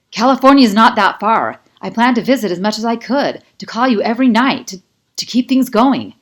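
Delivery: desperate, loud self-defence; heated defence. A narrator's reading. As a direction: make her see he meant to stay. Kai defends himself in the fight.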